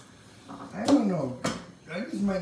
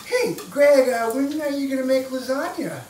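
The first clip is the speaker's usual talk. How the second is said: much higher, louder sibling call; 10 semitones higher, 7 dB louder than usual. Retold or imitated. Siblings calling Greg to cook lasagna, eager holler. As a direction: holler across the house for lasagna.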